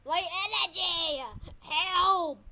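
loud, high, wailing yell; dramatic cry for help. Real, not acted. Player losing energy in the water, dramatic cry for help. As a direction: call out as if going under. Energy running out while swimming.

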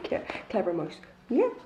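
ordinary delivery, nothing marked

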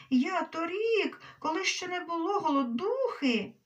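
high, loud outburst, building to a wail; wild shrieking. A narrator's reading. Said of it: "Кумедний Бойко дико заверещав". (дикий вереск, високо й голосно)

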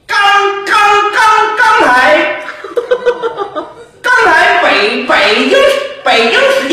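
刚，刚，刚 stuttered, 北京 dragged out slowly; a time announcer stuck on 刚才 and 北京; stammering announcer. Shown as a demonstration. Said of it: "报时费劲".

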